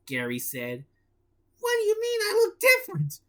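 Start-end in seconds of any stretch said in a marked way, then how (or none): 1.6-3.2 high, fast cartoon sponge; SpongeBob voice. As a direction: squeak it like SpongeBob. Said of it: "SpongeBob asked".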